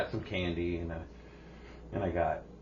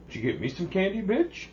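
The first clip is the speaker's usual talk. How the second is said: a hint of a whiny teen asking for candy; rising demanding son; mock son pestering mom; lightly voiced teen son; 10 semitones higher, 4 dB louder than usual.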